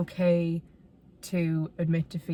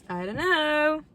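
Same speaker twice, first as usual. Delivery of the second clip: exasperated; 9 semitones higher, 3 dB louder, slower than usual. Real, not acted. Exasperated about her life.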